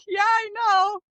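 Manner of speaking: high, mock-exasperated cry; exasperated admission. Client answering her therapist on oversharing, mock exasperation.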